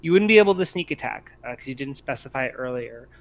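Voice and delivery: ordinary talk, nothing marked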